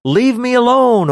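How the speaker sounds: high mock plea; demon pleading. Shown as a demonstration. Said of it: "actually means something more like".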